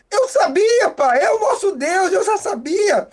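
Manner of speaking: put-on joyful child. Son sees the food basket.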